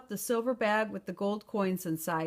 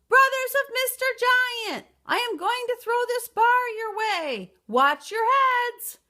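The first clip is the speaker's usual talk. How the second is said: loud cry; 13 semitones higher, 7 dB louder than usual. A narrator's reading. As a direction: hurl the warning westward.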